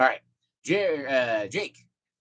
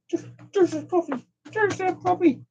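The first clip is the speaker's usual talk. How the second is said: high sing-song pet voice; talking to a dog. Real, not acted. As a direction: coo at the puppy, way up high. Speaker greets dog.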